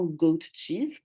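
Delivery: English words with a French accent; accent français en anglais. Real, not acted. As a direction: say "goat cheese" with a real French accent. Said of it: "Désolée pour mon accent anglais". (anglais prononcé avec l'accent français)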